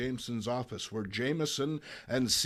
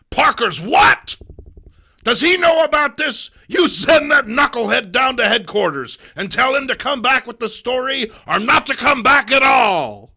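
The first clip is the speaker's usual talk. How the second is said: high, loud enraged editor; raging newspaper boss yelling orders; furious boss shout; 11 semitones higher, 15 dB louder than usual. Acted. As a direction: bellow the order across the office.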